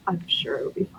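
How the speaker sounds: hushed doubtful aside; whispering. Acted. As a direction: whisper it like a secret worry. Whispered aside after agreeing.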